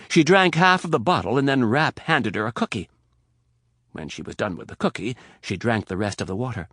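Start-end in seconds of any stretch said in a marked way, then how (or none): none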